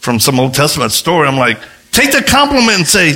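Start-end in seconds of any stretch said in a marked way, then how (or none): 1.9-3.1 high, emphatic mock exasperation; exasperated. Preacher fed up with false humility, exasperated. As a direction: insist on it, pitch jumping up.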